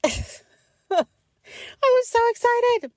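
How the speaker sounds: high laughing burst of excitement; giddy high laugh through words; laughing with glee. Real, not acted. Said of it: "laughs through it".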